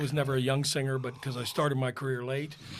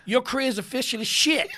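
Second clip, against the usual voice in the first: higher, louder mock verdict; teasing husband; 9 semitones higher, 5 dB louder than usual. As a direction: deliver the verdict with relish.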